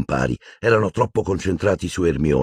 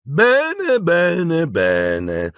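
high start, stepping down, drawn out; gloating villainess; 12 semitones higher, much slower than usual. A narrator's reading. Umbridge seeing Hermione crack, gloating drawl. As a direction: savour each word, falling in pitch. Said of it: "gongolò la Umbridge".

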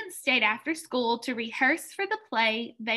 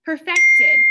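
Perfected said in one go, higher, much louder, rising at the end; whole word, loud; 5 semitones higher, 12 dB louder than usual. Shown as a demonstration. Teacher to class, the word blended back together: loud rising call. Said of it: "Those are the three syllables you hear in perfected".

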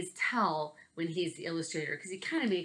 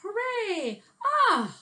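cheering; 9 dB louder, much slower than usual. Acted. Happy ending of story.

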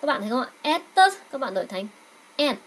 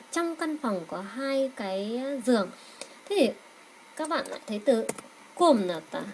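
ordinary talk, nothing marked

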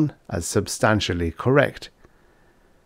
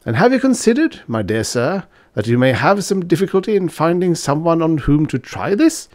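put-on bold young student; 9 semitones higher than usual. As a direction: challenge him, half teasing. Fritz presses the point.

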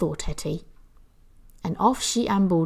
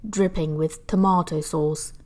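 plain reading voice

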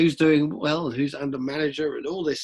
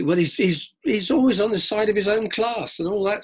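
higher, scoffing objection; mock objection; 8 semitones higher, 4 dB louder than usual. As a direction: scoff like a sceptic. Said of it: "you may say".

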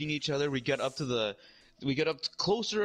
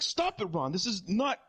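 raised, higher-pitched acted protest; exasperated pleading; 10 semitones higher than usual. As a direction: plead mid-fight, building higher. Acts out pleading during fight.

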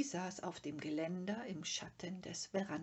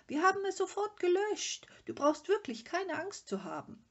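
high, loud, insistent reassurance; shouting after someone; 13 semitones higher, 8 dB louder than usual. A narrator's reading. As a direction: call after him, pitched high and urgent. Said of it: "schrie Mumintroll ihm nach". (laut und hoch nachrufend)

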